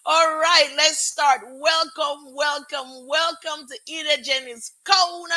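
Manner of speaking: high, sing-out host welcome; show opener. Real, not acted. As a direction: announce your show to the crowd. Host opens her new show.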